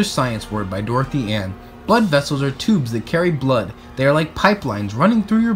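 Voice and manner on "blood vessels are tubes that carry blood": ordinary delivery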